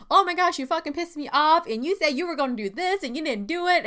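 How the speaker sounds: high, loud angry outburst; super mad. Shown as a demonstration. Confronting the boss, loud and furious. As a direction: come in hot, swearing. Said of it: "you see how the tone is, like, woo, super high and, like, super mad".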